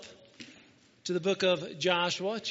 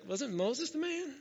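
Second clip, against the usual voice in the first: higher, softer unsure question; a timid, pitched-up doubt; Joshua asking; 6 semitones higher, 5 dB quieter than usual. Retold or imitated. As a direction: Joshua asks softly in a high, hesitant voice. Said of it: "Maybe he didn't do it like that".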